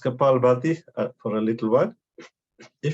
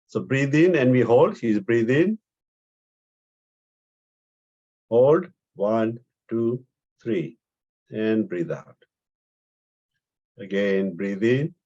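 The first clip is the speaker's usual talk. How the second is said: guided breathing; 3 semitones lower, slower than usual. Acted. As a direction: count their breaths gently down to a near murmur. Leads breathing counts.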